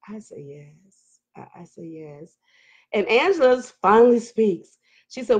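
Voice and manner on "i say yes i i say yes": barely audible, low whisper; hushed confession. Real, not acted. Streamer confiding her answer to a viewer's question, hushed confession. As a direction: slip it in very softly under your breath.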